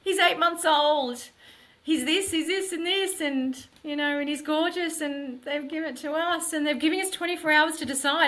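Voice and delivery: put-on husband telling his wife about their baby, elated and high; breathless good news. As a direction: pour out the news, barely containing joy. Husband relays adoption news.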